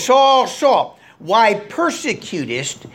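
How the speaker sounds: the voice of God calling to Saul. Acted. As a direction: cry out Saul, then draw out why persecutest thou me. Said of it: "this is peculiar to him".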